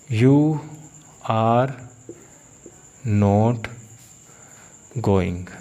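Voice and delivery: each word separated by pauses; slow, word by word. Shown as a demonstration. Teacher spacing each word for students. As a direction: space out every word. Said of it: "मैं कहूँ".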